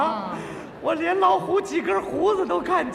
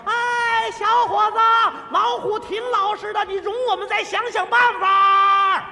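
raised, louder coaxing call; bystander calling down; 5 semitones higher, 5 dB louder than usual. Retold or imitated. Bystander shouts down to the trapped man, coaxing. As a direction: call down to the man in the pit, calm him. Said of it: "这个说".

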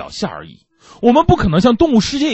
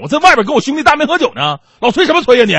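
mock tipsy bravado, a bit louder and higher; tough talk; 7 semitones higher, 4 dB louder, faster than usual. Drunk husband talks back to his wife on the phone, mock bold and louder. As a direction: puffs up and snaps back at the nagging wife. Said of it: "终于说了一句硬气的话".

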